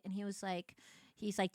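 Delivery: ordinary delivery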